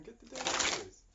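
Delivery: exasperated shout. Retold or imitated. Spirit telling the partner to act, loud exasperated shout. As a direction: yell it like the answer is obvious.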